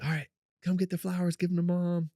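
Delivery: put-on high, excited stage whisper to the kid; eager urging. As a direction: hurry the kid along, thrilled. Urging daughter as mom arrives.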